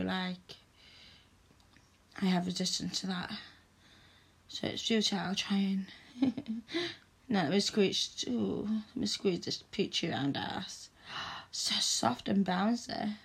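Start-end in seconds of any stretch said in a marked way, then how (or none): 6.2-6.9 breathy teasing laugh; a quick mocking laugh at him; short laugh. Mummy laughs at her little boy, teasing.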